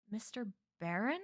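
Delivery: tentative question, rising at the end; doubtful. Acted. Boy asks the man behind the flashlight, doubtfully. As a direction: ask it as if unsure. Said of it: "I asked doubtfully".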